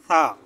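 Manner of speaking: সা said softly with the tongue tip between the teeth, like English th; soft tha sound. Shown as a demonstration. Teacher showing students সা soft with tongue out. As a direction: lisp সা gently through the teeth. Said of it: "জিহ্বাটা একটু বের করে ... নরম করে একটু বলবেন".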